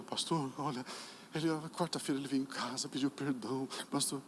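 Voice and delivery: soft, low, hushed report; imitated tearful mother. Mother tells pastor her son came home, soft imitated emotion. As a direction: speak softly, as if overcome. Mother returns moved with good news.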